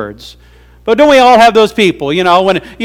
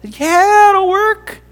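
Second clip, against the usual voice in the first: very high bird voice; chirpy bird; 14 semitones higher, much slower than usual. Acted. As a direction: squeak it like an eager little bird. Bird reassures turtle, voiced.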